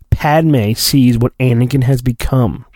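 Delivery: ordinary delivery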